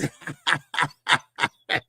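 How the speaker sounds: long run of chuckles; real drawn-out cackling laugh. Real, not acted. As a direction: laugh steadily at your own joke. Laughs at his cornbread joke.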